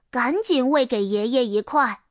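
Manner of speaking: ordinary delivery, nothing marked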